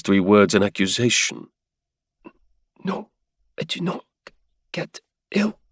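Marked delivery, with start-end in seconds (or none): 2.8-5.6 a feverish woman, barely a whisper; faint high whisper of a sick woman; threadbare whisper. Acted. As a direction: breathe it out, almost gone. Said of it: "Her assurances came so threadbare".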